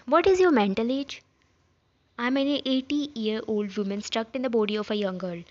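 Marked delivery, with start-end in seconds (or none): 0.1-1.2 louder, incredulous question; exasperated challenge. Acted. Character challenging another's mental age, exasperated and loud. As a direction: demand an answer, raising your voice.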